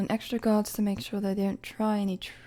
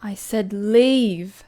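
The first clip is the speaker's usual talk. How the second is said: louder, very slow, final warning; stern command; 8 dB louder, much slower than usual. Acted. Queen dismissing a presumptuous commoner, stern. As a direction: lean into the word leave.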